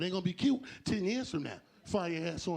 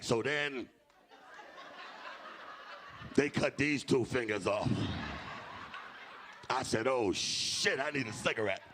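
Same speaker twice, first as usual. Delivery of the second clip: mock ad smoker; 5 semitones lower, slower than usual. Imitating smoker from ad.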